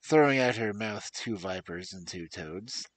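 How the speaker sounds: storyteller reading aloud; story narration. Acted. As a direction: tell the fairy tale to listeners. Narrates fairy tale.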